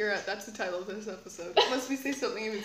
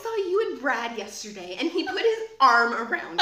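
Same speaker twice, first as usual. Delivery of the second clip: put-on higher, louder, accusing teen gossip; bitchy eighth grader; 5 semitones higher, 8 dB louder than usual. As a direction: corner her with catty gossip. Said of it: "That's like a bitchy eighth grader".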